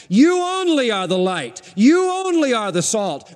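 "You" pitched high and held, then falling; stress on You. Shown as a demonstration. Preacher showing his congregation the emphatic pronoun, stressing "You". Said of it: "The pronouns are emphatic".

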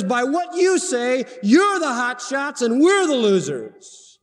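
high, stretched pulpit mockery; full-force sarcastic preaching. Preacher putting Paul's taunt in plain words, sarcastic preaching. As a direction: cry out the mock contrast, high and slow.

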